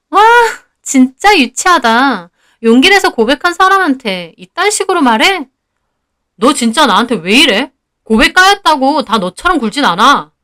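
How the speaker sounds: acted offended retort, lively pitch; hurt indignation. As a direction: reproach a classmate who threw your confession back. Schoolgirl retorts in acted quarrel.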